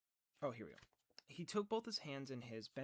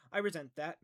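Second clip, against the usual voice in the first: loud, indignant snap; hot resentment; 3 semitones higher, 12 dB louder than usual. A narrator's reading. Meg stung by Calvin, answering hotly. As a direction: bite back at the insult. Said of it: "Meg said hotly".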